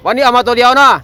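speaking up loudly. Real, not acted. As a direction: call it out so he catches it. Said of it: "พูดดังๆเนาะ เสียงหูไม่ค่อยดี". (พูดดังๆ เสียงสูงให้คนหูไม่ดีได้ยิน)

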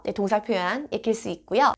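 ordinary talk, nothing marked

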